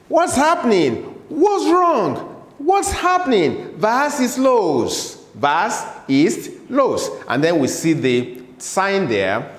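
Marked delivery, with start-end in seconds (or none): 0.1-3.8 high bright voice on What's happening; lively translation. Shown as a demonstration. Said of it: "It simply means". 3.8-7.1 Was ist los drawn out slowly, falling each time; slow model repetition. Shown as a demonstration. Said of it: "say it after me".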